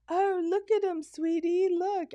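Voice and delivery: mock an onlooker's eager delight; imitated delight. Man showing his wife an approaching boar, imitated delight.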